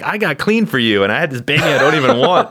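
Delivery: high, pleading mock outburst; ex-addict wife. Host imitating mayor's wife complaining about baby, high mock-plaintive. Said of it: "She's like".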